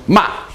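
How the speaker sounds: MA dropping sharply from high; short, sharp falling MA; fourth tone. Shown as a demonstration. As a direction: start MA high and drop it fast. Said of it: "fourth tone".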